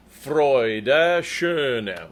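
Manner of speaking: "Freude, schöne" evenly stretched in rhythm; spoken on the beat. Shown as a demonstration. Coach showing the beats, "Freude, schöne" in measured rhythm. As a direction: place each syllable steadily on the beat. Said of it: "those are the beats".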